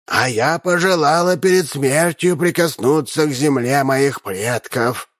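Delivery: put-on slow, creaky old-lady voice; an aged countess wishing to touch her homeland; creaking old countess. Old countess to the crowd, a lightly voiced slow creak. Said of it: "проскрипела графиня Мейндорф".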